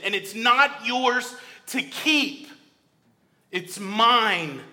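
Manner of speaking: loud, high, impassioned plea; preaching at full force. Acted. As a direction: plead with the congregation to bring their sin.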